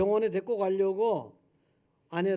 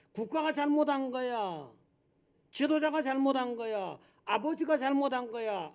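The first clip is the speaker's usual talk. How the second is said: higher, pointing-the-finger voice; mock blamer; 6 semitones higher than usual. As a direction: play the one who blames everyone else. Voices blame-shifting people. (남 탓하는 사람 흉내)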